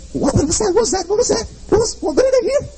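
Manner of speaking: hammed-up frightened shepherd; startled David. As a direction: squeak it out like a spooked boy.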